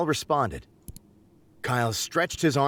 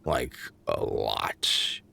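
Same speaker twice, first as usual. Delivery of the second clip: Kyle voice; 7 semitones lower than usual. A narrator's reading.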